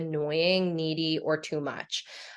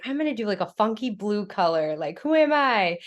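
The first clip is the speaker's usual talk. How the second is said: higher-pitched, giddy quote of oneself; playful excitement; 4 semitones higher than usual. Retold or imitated. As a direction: act out being tickled by your own bold choice. Retelling own playful nail color choice.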